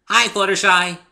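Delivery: high, loud cheerful call; bubbly pony hollering hello. A narrator's reading. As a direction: sing out a greeting into the coop. Calling into coop.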